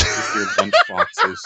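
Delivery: high, laughing jab, slowed; laughing tease. Real, not acted. Co-host teasing his friend about toys, laughing. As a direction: laugh through the teasing words.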